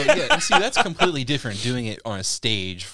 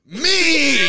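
high, loud shout of Me!; climax cry; 13 semitones higher, 7 dB louder than usual. Retold or imitated. Comedian shouting at his own reflection, high loud cry. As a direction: belt out Me! like peaking in the mirror. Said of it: "and you start going".

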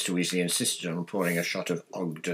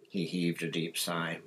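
plain reading voice

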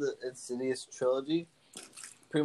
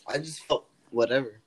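normal talking voice

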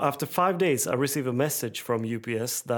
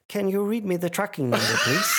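agent's voice; 7 semitones higher, 3 dB louder, faster than usual. Retold or imitated. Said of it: "she said".

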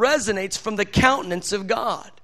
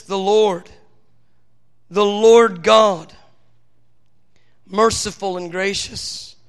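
solemn scripture reading; 3 semitones lower than usual. Acted. Preacher reading verse six, solemn proclamation. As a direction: read it as holy declaration.

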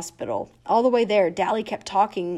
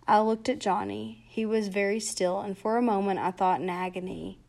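plain reading voice

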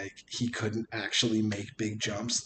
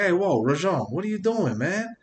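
higher, louder mock New Yorker; hammed-up New York heckle, pitch climbing; New York accent; 9 semitones higher, 4 dB louder than usual. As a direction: call out in a put-on New York voice. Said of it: "started busting out everything".

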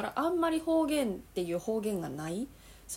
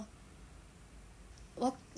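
ordinary delivery

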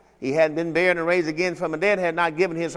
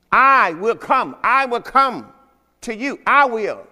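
drawn out, raised preaching of the verse; slow emphatic scripture quote; 6 semitones higher, 5 dB louder, slower than usual. Acted. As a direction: lean on each I will. Quoting Jesus with emphasis.

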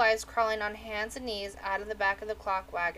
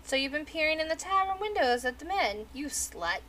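put-on old crone voice; 6 semitones higher than usual. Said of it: "The crone said".

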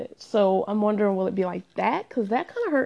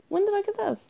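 high, softer mock puzzlement; puzzled surprise; 10 semitones higher, 4 dB quieter, faster than usual. Vlogger rediscovering old stuff in the closet, puzzled surprise. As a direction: wonder aloud in a high-pitched voice. Acts finding forgotten purchases.

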